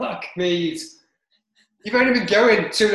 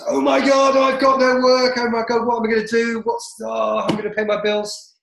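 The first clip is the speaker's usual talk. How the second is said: mock panic; 6 semitones higher than usual. Imitating himself panicking with no work left, high mock alarm. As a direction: fret dramatically about the bills. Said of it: "And it's".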